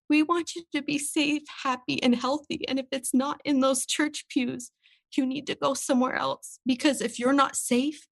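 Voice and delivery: pushing through tears to quote a loving message; tearful, pitched high and fragile; emotional, near tears. Real, not acted. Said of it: "I'm getting a little emotional".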